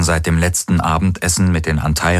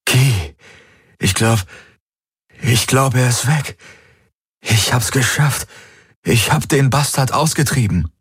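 higher-pitched, breaking the news of his victory; relieved; 7 semitones higher than usual. A narrator's reading. Captive telling Ki he has driven the bastard out, relieved. Captive claims he expelled the intruder.

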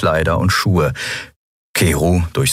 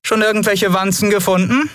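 higher call through a door. A narrator's reading. Lien calling from the bathroom to the others, raised call. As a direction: call out from the next room. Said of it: "hörten sie Lien rufen".

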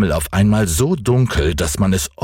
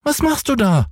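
sehr hohe Kinderstimme, fragend; neugieriger Häwelmann. A narrator's reading. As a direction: ein Junge ruft neugierig hinauf. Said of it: "rief der kleine Häwelmann hinauf". (hohe, neugierige Kinderfrage)

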